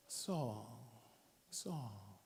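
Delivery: low soft calling of a name; hushed call. Retold or imitated. Jesus calling Saul on the road, hushed. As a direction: call his name gently, almost breathed.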